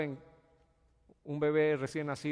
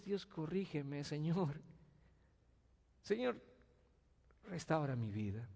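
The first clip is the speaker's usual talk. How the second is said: humble prayer; 8 dB quieter than usual. Acted. Voiced humble prayer to God.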